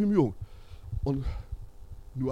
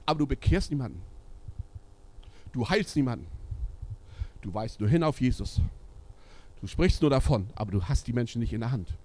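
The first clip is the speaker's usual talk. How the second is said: normal talking voice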